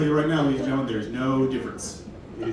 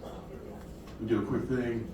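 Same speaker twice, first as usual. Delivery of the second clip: ordinary delivery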